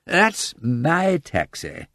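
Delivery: very slow tipsy dignity; drunken dignity. Acted. Lord Peter claims the taxi with tipsy dignity. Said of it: "with dignity".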